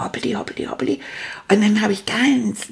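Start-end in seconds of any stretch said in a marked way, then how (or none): 0.0-1.0 sneaky whispered hop; whispering. Acted. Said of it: "ganz leise ins Schlafzimmer gelaufen".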